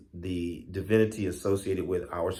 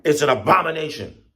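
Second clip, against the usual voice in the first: raised, louder cry with force; retold shout; 7 semitones higher, 7 dB louder than usual. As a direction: relay the rat's shouted alarm. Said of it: "and he shouted".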